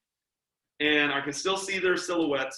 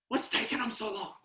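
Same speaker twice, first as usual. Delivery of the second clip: high, hushed voice; imitated stage whisper; 6 semitones higher, 7 dB quieter than usual. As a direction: whisper as if from a hiding place. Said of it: "Why are we whispering?".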